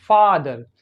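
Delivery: फादर with a long, open, unrounded AH. Shown as a demonstration. Teacher giving a model word for American O, long open AH in फादर. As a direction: open the mouth on the first vowel of फादर. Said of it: "open करते हैं".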